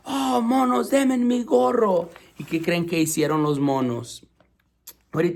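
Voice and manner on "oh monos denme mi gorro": high pleading-scold; scolding peddler. A narrator's reading. Vendedor enojado pidiendo su gorro. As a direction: shake both hands at the monkeys. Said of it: "con las dos manos les dijo".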